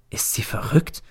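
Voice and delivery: breathless hushed question; an unvoiced, secret doubt; whispered thought. A narrator's reading. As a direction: whisper it to yourself in disbelief. Narrator's inner whispered question.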